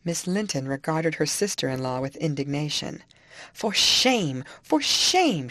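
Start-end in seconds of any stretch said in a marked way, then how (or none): 3.5-4.4 a louder, stern cry of "shame"; angry. A narrator's reading. Indignant rebuke. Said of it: "she repeated angrily". 4.6-5.5 a much higher, louder repeat of "shame"; Isabella, louder, rebukes Catherine; angrier. A narrator's reading. Furious high rebuke. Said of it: "she repeated angrily".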